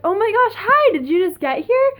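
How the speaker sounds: high, loud, imitated surprise; excited greeting. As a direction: act out a delighted, astonished hello. Band member greets late fan.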